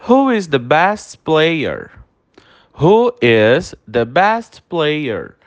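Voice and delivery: very slow, deliberate English phrase; drilling it slowly in class; very slow model sentence. Shown as a demonstration. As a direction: stretch every word for the class to copy. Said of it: "Repete a pronúncia comigo".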